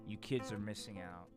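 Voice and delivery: ordinary talk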